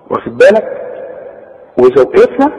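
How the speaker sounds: ordinary delivery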